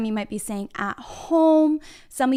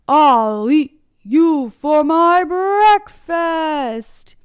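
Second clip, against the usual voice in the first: loud, drawn-out troll roar; menacing troll; 4 semitones higher, 9 dB louder, much slower than usual. Acted. Troll threatens the little billy goat, slow loud troll voice. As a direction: bellow it slowly like a hungry storybook troll. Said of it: "What does the troll say?".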